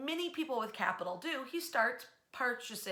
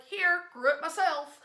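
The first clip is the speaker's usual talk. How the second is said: hearty offer, higher and louder; a lightly voiced proud gentleman farmer; proud old gent; 6 semitones higher, 7 dB louder than usual. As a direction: hand over the turkey proudly, voice raised.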